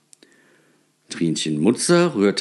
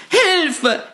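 much higher, loud terrified cries; screaming for help; 4 dB louder than usual. A narrator's reading. Frightened shopkeeper screams for help in the night. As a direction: shriek for help in panic. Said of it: "schrie sie auf einmal".

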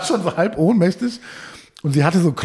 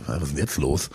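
a low, hushed what-now; mock bewildered mumble; 13 semitones lower, 8 dB quieter than usual. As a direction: drop much lower and softer, baffled. Mock bewildered reply in retold story.

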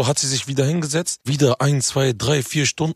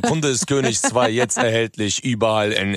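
promo read; 4 semitones lower than usual. Acted. Rapper plugs his new album live on air, a practised ad read. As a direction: announce the album like a radio spot. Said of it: "das hast du jetzt aber geübt".

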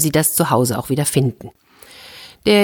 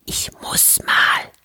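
slow, urgent whisper; 4 dB quieter, much slower than usual. A narrator's reading. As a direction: hush it, don't let the robbers hear. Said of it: "da flüsterte der Bruder".

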